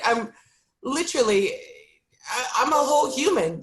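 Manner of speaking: fighting not to cry as she speaks; a real catch of crying in the voice; near tears. Real, not acted. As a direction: voice cracking with real tears held back. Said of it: "I want to".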